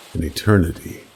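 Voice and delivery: usual speaking voice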